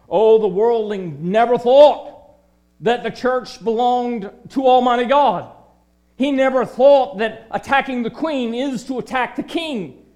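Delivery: loud, driving pulpit cry; full-force preaching. Acted. Preacher thundering at the unbeliever, loud pulpit fervor. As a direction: preach it hard to the congregation.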